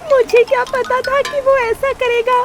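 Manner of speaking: high-pitched, acted crying voice; tearful little bird. A narrator's reading. Bird explaining herself to the parrot, tearful. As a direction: wail it as a hurt storybook bird. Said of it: "उसे रोते हुए सारी बात बताई".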